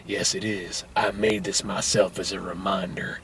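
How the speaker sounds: low, very soft, slow voice; mock-profound near whisper; hushed solemn murmur. Joe explains the angel to the girl, hushed solemn.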